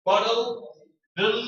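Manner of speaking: BOTTLE, then its final "bl" alone, very clear; very clear ending. Shown as a demonstration. Teacher drilling the T-T-L-E ending, very clearly. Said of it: "has to be very clear".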